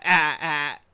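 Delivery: knowingly bad laugh impression; imitated Spooky laugh. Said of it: "I'm really bad at doing the Spooky laugh".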